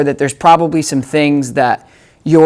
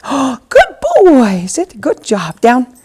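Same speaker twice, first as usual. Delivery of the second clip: delighted, praise him up; excited praise; 8 semitones higher, much slower than usual. Real, not acted. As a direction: gasp then bright praise.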